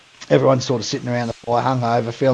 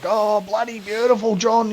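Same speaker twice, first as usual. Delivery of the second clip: high mock appreciation; imitated gushing praise; 6 semitones higher, 4 dB quieter than usual. Diners on camera praise the cook, high imitated appreciation.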